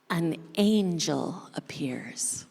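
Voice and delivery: put-on a secret reveal breathed out to the children; hushed whisper. A narrator's reading. Narrator reveals angel, hushed.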